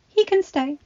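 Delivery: put-on high, quick young woman's voice; Belle, urgent. A narrator's reading. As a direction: blurt it out to keep him near. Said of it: "Belle said quickly".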